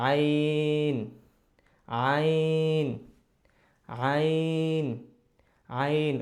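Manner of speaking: "আইন" stretched on a level note from mid-throat; throaty Arabic Ayn. Shown as a demonstration. Teacher modelling the letter, long throat-made "আইন". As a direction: squeeze the middle throat and draw out "আইন". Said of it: "কণ্ঠনালীর মাঝখান থেকে".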